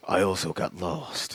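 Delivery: low, slow menacing monster voice; a dragged-out growl of a line; slow brooding creature voice; deep villain voice. Retold or imitated. Deep villain voice retelling movie.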